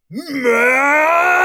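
loud, high villain's bellow. A narrator's reading. General Goob bellows for Max, a roaring shout. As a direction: roar her name down the halls. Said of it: "General Goob bellowed".

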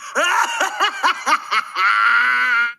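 big gloating evil laugh; villain laugh. Acted. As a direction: cackle like a triumphant villain. Villain laughing after bursting in.

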